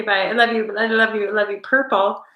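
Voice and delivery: ordinary talk, nothing marked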